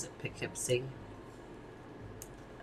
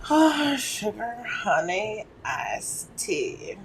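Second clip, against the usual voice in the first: put-on high, airy Valley girl preening; much higher, chirpy Valley girl; 10 semitones higher, 5 dB louder, much slower than usual. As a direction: play the ditzy California girl. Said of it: "a Valley girl".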